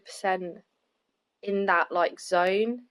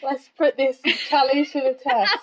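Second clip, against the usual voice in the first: mock rallying call; 5 semitones higher, 5 dB louder than usual. Mock rallying the troops, "joking.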